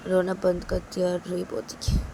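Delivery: ordinary delivery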